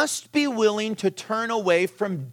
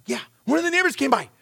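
quick confirmation; 8 semitones higher, faster than usual. Retold or imitated. Daughter confirms the news.

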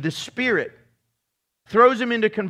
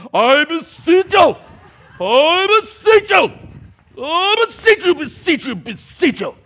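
mock exorcist shout; 7 semitones higher, 8 dB louder than usual. Preacher parodying a showy exorcism for the church, loud theatrical beseeching. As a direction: wail it repeatedly, fading at the end. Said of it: "it wasn't like The Exorcist".

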